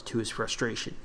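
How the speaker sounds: plain reading voice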